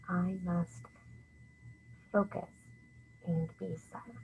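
soft, word-by-word count with pauses. Shown as a demonstration. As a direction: count out each word quietly, pausing between. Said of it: "I'm gonna tap on the board".

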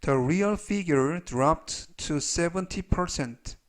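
very slow, measured English read-aloud; slow model reading. Shown as a demonstration. Teacher reads example sentences for learners to review, slowly. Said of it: "천천히 세 번씩 읽어드리고요".

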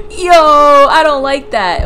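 spooked by the game; nervous scared laugh. Real, not acted. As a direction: laugh from fear then drag it out.

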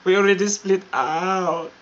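a bolder second take. Shown as a demonstration. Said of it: "Ano yun?".

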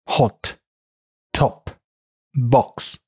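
British hot, top, box with short rounded o; British English demo of hot, top, box; British pronunciation. Shown as a demonstration. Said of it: "英音".

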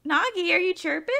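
high, cooing question; pet talk. Real, not acted. Player talking to chirping pet Nagi, high-pitched pet voice.

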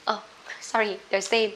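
ordinary talk, nothing marked

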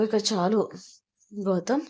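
plain reading voice

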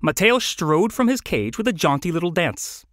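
ordinary delivery

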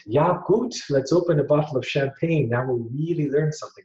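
put-on loud, high-pitched Swiss accent. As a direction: exclaim it in a jovial Swiss accent, laughter creeping in. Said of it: "he'd say in a Swiss accent".

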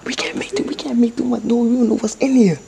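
ordinary delivery

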